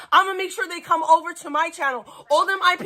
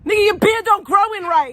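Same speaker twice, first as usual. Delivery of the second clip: loud, higher roast; teasing shout; 4 semitones higher, 5 dB louder than usual. Real, not acted. Streamer yelling a roast at his friend's beard. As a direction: yell the jab across the room.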